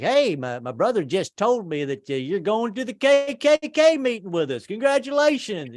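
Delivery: hammed-up high Southern teen; big brother. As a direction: welcome him warmly into the group. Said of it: "He was like".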